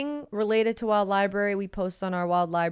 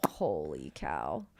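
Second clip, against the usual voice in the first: slow, much lower, awed mutter; low stunned; 10 dB quieter, much slower than usual. Real, not acted. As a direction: drop the voice in disbelief. Repeats reaction lower.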